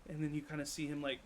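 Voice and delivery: normal talking voice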